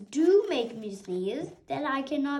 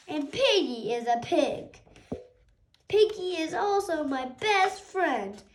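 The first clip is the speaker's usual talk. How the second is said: usual speaking voice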